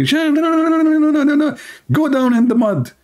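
high, shrill-pitched repeated no's, yelled; drill sergeant screaming. Retold or imitated. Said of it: "he screamed at me".

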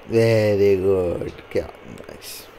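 very slow, low mock praise; drawn-out praise. Praising his co-op partner in a slow, low, drawn-out tease. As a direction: stretch out the very good teasingly.

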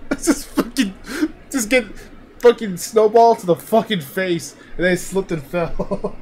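high, loud, cracking up; real laughter breaking up the sentence; laughing while talking. Real, not acted. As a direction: squeeze the words out between laughs. Retelling funny clip while laughing.

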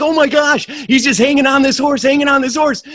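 very high, loud panic; terrified rider clinging to a bolting horse; panicked. Acted. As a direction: squeal like you're barely hanging on. Guy clinging to galloping horse.

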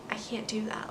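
hushed, whispered inner protest; whispered aside. Retold or imitated. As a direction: whisper it like a private thought. Voicing own reluctant reaction.

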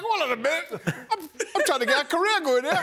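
high-pitched laughing protest; laughing protest about his career. Retold or imitated. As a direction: squeak it out through laughter. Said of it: "I could not stop laughing".

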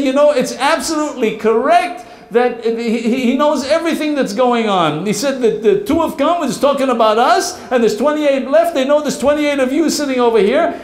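high, stammering alarm; frightened warning to the gang; nervous report. Acted.

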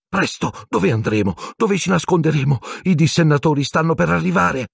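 panicked moan. Acted. Hermione urging Harry to run, a panicked cry. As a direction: panic as the werewolf comes. Fleeing the werewolf and dementors.

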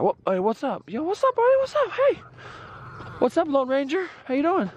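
high sing-song pet voice; talking to chickens. Real, not acted. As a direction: coo at the animals in a high voice.

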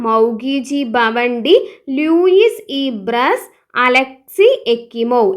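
very slow, each name spelled out syllable by syllable; slow dictation. Shown as a demonstration. Teacher dictating the chemistry winners, very slow. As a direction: dictate the names slowly for note-taking. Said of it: "ഒന്നുകൂടി പറയാം".

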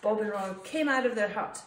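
plain reading voice

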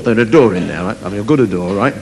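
ordinary delivery

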